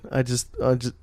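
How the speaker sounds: ordinary delivery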